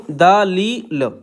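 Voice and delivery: दलील stretched out very slowly; very slow dictation of दलील. Shown as a demonstration. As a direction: draw out दलील as the pen writes. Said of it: "इस तरीके से।".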